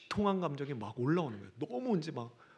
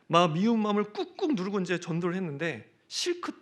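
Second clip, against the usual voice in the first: usual speaking voice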